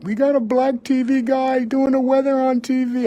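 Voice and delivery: high, loud, excited gossiping voice; townsfolk buzzing. Retold or imitated. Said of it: "everybody's like".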